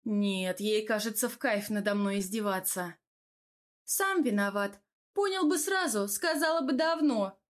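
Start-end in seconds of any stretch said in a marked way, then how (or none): 3.8-7.4 higher-pitched woman's retort; testy comeback in a raised pitch; snapping back. A narrator's reading. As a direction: snap back defensively, voice lifted. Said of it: "огрызнулась Тамара".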